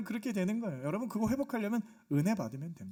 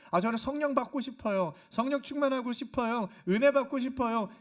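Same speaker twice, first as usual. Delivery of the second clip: acted eager plea, higher and louder; eager confession; 5 semitones higher, 5 dB louder than usual. Congregant confessing a wish for grace, acted eager confession. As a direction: plead like an earnest congregant.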